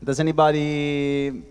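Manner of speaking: normal talking voice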